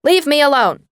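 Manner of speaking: loud, high angry outburst; angry yell. A narrator's reading. Girl tells her mother to leave her alone, angry yell. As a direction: lash out at her. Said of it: "fueling her anger".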